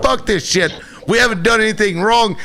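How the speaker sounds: high, indignant mock protest; mouthing off. Teenager protesting his arrest to police, mouthing off. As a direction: act out a mouthy teen protesting. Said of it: "you know, mouthing".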